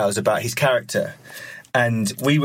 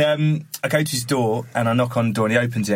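usual speaking voice

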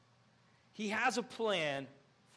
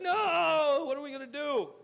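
mock panic, wailing helplessly; freaking out; 6 semitones higher, 7 dB louder than usual. Freaker outer facing a battle, freaking out. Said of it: "you're freaking out".

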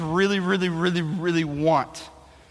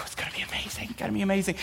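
whispered, breathless hope; excited whisper; 11 dB quieter, faster than usual. Retold or imitated. A boy whispering to himself on Christmas Eve. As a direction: whisper it to yourself, can't wait.

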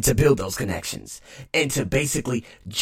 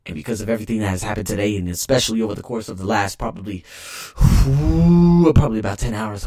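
normal talking voice

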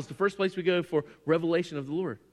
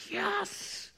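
high, small squeal of delight; excited hobbits; 7 dB quieter than usual. Retold or imitated. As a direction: mimic thrilled little hobbits. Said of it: "they're like".

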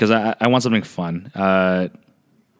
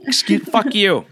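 mock rude snap; 10 semitones higher than usual. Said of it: "I'm like".

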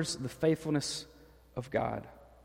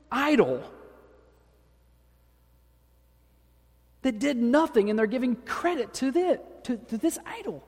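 rising to high outrage on stupid idol; indignant God; 7 semitones higher than usual. Retold or imitated. God vents anger at the people's idol, voice climbing. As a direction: build to a high, disgusted rant. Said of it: "where God says".

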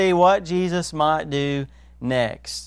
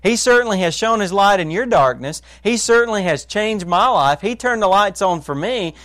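ordinary talk

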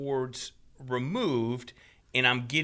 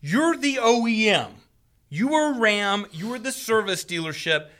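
louder, higher retold tirade; indignant customer laying down the facts; getting mad; 5 semitones higher, 3 dB louder than usual. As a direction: hammer each accusation, reenacting the argument. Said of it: "now I'm getting mad".

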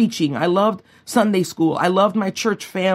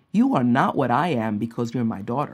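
soft, lower voice of a gentle mother; sweet, motherly; 5 semitones lower, 4 dB quieter than usual. Retold or imitated. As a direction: tenderly set your child straight, softly. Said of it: "very sweetly said to me".

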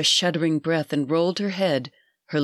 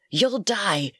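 strained, higher choking warning; gasping; 4 semitones higher than usual. A narrator's reading. Old woman warns the girl gripping her neck, gasping. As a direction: force it out as if being throttled. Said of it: "gasped the old woman".

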